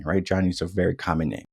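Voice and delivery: ordinary delivery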